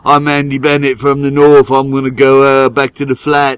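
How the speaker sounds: loud mock Northern English accent. Friend from the north heading off to smoke, loud Northern English accent. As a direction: announce it as a northern English mate. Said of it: "and then he's like".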